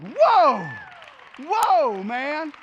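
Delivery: high, loud astonished exclamation; awed whoa. Retold or imitated. Answering the fasting man in church, loud awe. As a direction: blown away by this guy.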